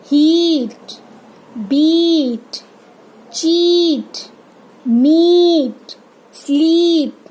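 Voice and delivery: stretched ee in हीट, बीट, चीट, मीट, स्लीप; slow separate words, each ee held; long i. Shown as a demonstration. Teacher models the big i, हीट to स्लीप drawn out. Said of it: "बड़ी ई का sound और ये longer".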